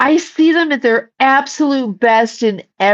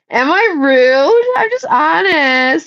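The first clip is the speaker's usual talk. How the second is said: mock whine; 7 semitones higher, slower than usual. Mock-whining about being rude.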